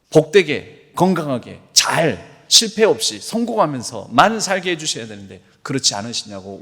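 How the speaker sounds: preaching. Acted. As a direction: preach it with conviction to the congregation. Preacher's emphatic passage again.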